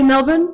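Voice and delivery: "Melbourne" tried the Australian way, R dropped; trying Melbourne without R. Shown as a demonstration. American asking whether she should say Melbourne without R. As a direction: tentatively ask "Melbourne" with no R. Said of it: "picking up an accent when I say it".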